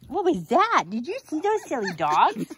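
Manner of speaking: high, loud delighted voice; excited pet talk. Real, not acted. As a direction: gush at the silly dogs. Excited at dogs playing.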